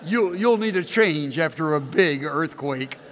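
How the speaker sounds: ordinary talk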